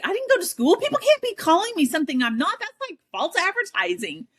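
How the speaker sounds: indignant protest. Retold or imitated. Protesting to Gary.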